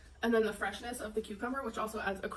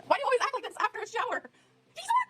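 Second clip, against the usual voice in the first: very high, fast teasing pet voice; 12 semitones higher, faster than usual. Real, not acted. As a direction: scold the dog playfully, squeaky. Talking to hyper dog.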